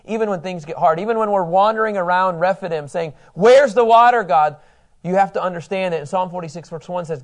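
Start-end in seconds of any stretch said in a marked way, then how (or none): 3.3-4.6 loud, higher acted demand; demanding complaint. Preacher voicing thirsty wanderers to God, demanding complaint. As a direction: call out as if indignant.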